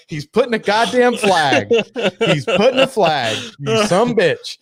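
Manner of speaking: slow, high indignant mock rant; teasing his cohost for flagging a joke, mock outrage. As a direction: call him out like he's been caught. Teasing cohost marking flag.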